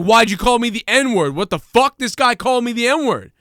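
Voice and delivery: staged indignant shouting; mock accusing yell. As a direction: fake a loud public accusation. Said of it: "And then just be like".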